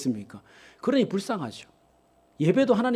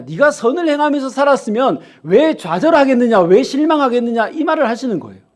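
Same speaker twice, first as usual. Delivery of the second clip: preaching at full force; 3 semitones higher, 7 dB louder than usual. Acted. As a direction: drive the point home to the pews. Preaching builds to a climax.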